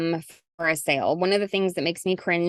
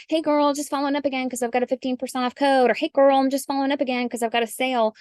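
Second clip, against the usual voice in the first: put-on higher, fast salesy hey girl; hammed-up chirpy sales voice; mocking pushy seller; 6 semitones higher, faster than usual. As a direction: parody a desperate upsell pitch. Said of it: "So instead of just like".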